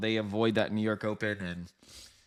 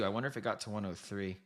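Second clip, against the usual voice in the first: ordinary talk, nothing marked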